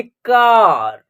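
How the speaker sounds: "कार" with the 'aa' stretched out; teacher's model "कार", A as long 'aa'; long 'aa' sound. Shown as a demonstration. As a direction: hold the open vowel in "कार". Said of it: "ए का उच्चारण आ हो रहा".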